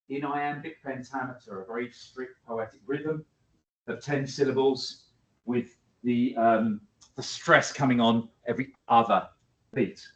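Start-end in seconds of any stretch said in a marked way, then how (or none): none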